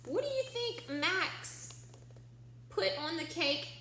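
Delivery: ordinary delivery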